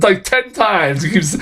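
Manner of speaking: ordinary delivery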